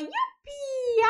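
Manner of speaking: high, squealed cheer; baby squeal. Acted. Baby voiced in doll play.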